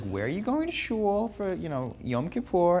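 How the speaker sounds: put-on doing mom in a New York accent. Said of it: "I'm giving her a New York accent".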